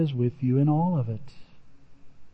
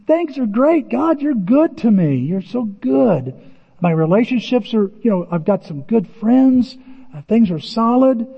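carefree gushing; 5 semitones higher, 3 dB louder than usual. Retold or imitated. Acting out a carefree believer.

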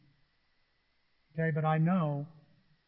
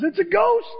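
high, loud terrified shriek; panicked cry; 13 semitones higher, 6 dB louder than usual. Acted. Disciples see Jesus on the water, a high panicked shriek. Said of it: "someone says".

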